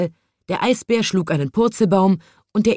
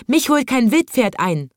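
high boastful cry; mild shout; 6 semitones higher than usual. A narrator's reading. Third man bragging of his speed, crying out high. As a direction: keep boasting in a raised, high voice. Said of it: "schrie ein dritter".

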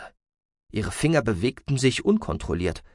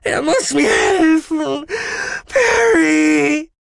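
high, loud, desperate plea; wailing cry of terror; panicked scream; 7 dB louder, much slower than usual. A narrator's reading. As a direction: shriek for help, stretching the words. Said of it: "rief es mit panischer Stimme".

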